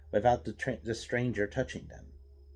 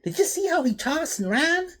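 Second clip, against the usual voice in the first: high, loud astonished cry; amazed; 6 dB louder than usual. A narrator's reading. Thug amazed at the stranger, high excited cry. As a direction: voice jumps up in disbelief. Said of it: "said Zos".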